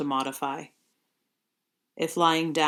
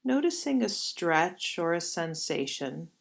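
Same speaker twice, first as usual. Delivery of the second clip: guided meditation. Acted. Leads yoga nidra movement.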